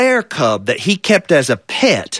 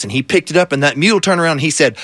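usual speaking voice

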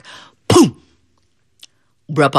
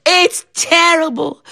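high, slow fake sorrow; mock grief; 12 semitones higher, slower than usual. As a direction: lament it theatrically. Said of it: "Brer Rabbit said".

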